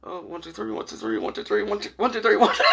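acting out frantic secret counting; nervous muttering. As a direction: start under the breath and let it creep up.

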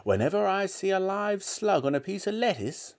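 put-on high old-woman voice; relishing grandmother. A narrator's reading. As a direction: savour it as a horrid granny. Grandmother relishing disgusting food.

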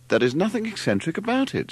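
high, taken-aback protest; as if the objection is absurd; surprised. A narrator's reading. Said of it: "said Larry, surprised".

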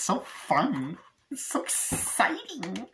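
slow, high put-on voice; mock enthusiasm. Reviewer grumbling about a long review, mock enthusiasm. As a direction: stretch it out high, faking the thrill.

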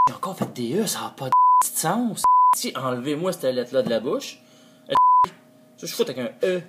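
exasperated rant. Real, not acted. Ranting about his filler E.

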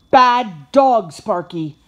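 loud, sharp reprimand, easing on the name; scolding. A narrator's reading. Josh catching his puppy eating treats, scolding.

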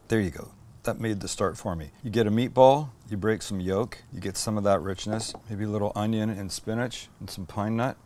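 normal talking voice

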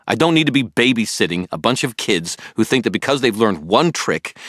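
defiant rant; furious outburst. A narrator's reading. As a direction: unload all your frustration. Harry's furious outburst at Billy.

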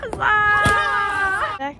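high, loud huzzah; shouted cheer. Acted. Vlogger shouts huzzah with the reenactors. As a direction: whoop it like a patriot.

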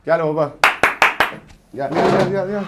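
loud beckoning call. Real, not acted. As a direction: call someone over, rising. Çağırıyor, seslenme. (seslenerek çağırma)

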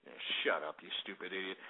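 low, soft grumbled insult; deep, quiet disgust; muttered dismissal. Real, not acted. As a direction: brush the caller off under your breath. Dismissing a prank caller.

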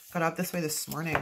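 normal talking voice

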